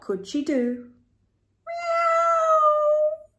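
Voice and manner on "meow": loud, very high cat wail; crying meow. A narrator's reading. Narrator voices stuck cat nobody hears, crying louder. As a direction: yowl it high and long. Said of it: "she cried".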